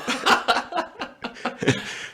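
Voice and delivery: breathy high laugh; burst of laughter. Real, not acted. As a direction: let out a big airy laugh at the memory. Joke about the roll call.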